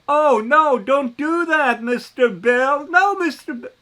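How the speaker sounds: high, loud mocking scold of the gullible; shrill-free high pitched mock panic, rising to a squeal on Mister Bill; mock alarmed warning. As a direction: ham up a fretful nanny warning the public. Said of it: "Oh, I get carried away".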